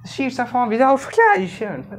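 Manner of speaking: mumbled mock British English, high and slow; mock foreign English accent. Teacher mocking foreigners' English to students, mumbled mock foreign accent. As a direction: slur it like a foreigner nobody can follow. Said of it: "अभी विदेशियों की अंग्रेजी सुन लो ना".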